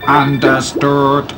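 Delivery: slow, even machine voice; robot voice. A narrator's reading. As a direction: speak like a cold machine. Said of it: "said the robot".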